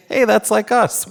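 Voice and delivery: high, laughing aside to the listener; a real amused giggle, tossed off. Real, not acted. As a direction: giggle through it, amused by the book. Reader laughs at the story.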